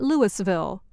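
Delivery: Louisville said "LOO-is-vill"; Louisville as spelt, "LOO-iss-vill"; mispronounced. Shown as a demonstration. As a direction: sound the S in Louisville. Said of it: "not Louisville".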